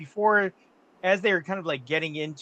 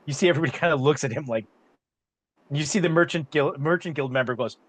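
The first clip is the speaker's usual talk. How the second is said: ordinary talk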